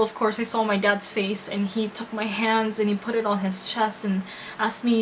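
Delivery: ordinary talk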